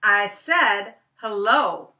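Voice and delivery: slow, loud, stressed repeat; insistent Brer Rabbit. Acted. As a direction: spell it out slowly, losing patience. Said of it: "Brer Rabbit says".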